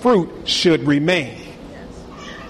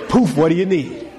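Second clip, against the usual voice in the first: tired genie again; weary genie pop-out. Retold or imitated. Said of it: "jump out and go".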